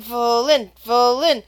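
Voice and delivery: usual speaking voice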